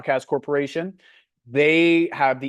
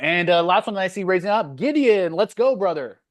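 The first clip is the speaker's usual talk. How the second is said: higher and louder, calling the next one up with energy; rally the group as you hand over the mic; hyped call-out; 6 semitones higher, 4 dB louder than usual. Real, not acted. Host hyping up Gideon as he calls on him, excited.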